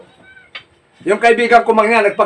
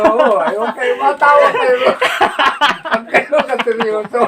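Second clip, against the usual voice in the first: laughing; 4 semitones higher than usual. Real, not acted. Teller cracking up at own joke.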